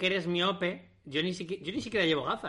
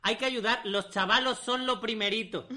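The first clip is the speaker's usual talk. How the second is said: put-on higher-pitched take-off of a thick Nicaraguan accent; imitated Nicaraguan accent; 4 semitones higher than usual. Retelling a friend's TV interview in Nicaragua, imitated Nicaraguan accent. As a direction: mimic a local man's thick Nicaraguan Spanish. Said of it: "en castellano cerrado, de repente decir".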